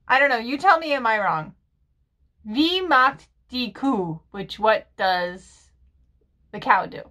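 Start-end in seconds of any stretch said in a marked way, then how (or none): none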